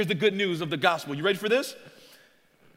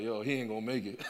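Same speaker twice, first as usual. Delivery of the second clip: muttered aside; 11 semitones lower, 8 dB quieter, faster than usual. Retold or imitated. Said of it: "You're like".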